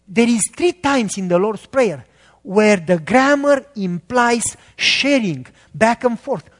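ordinary delivery

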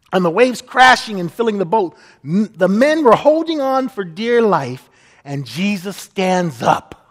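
ordinary delivery

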